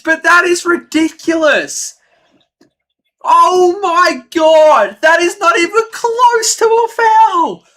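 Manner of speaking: high, loud outraged ranting; angry yelling. Real, not acted. Fan streamer still yelling about the foul, angry. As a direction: keep shouting down the call.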